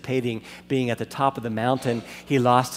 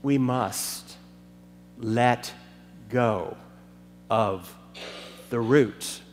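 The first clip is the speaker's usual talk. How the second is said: each word set apart by long pauses, lower; slow emphatic words; 4 semitones lower, slower than usual. Real, not acted. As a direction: drive home every word, one at a time. Sermon's climactic slow line.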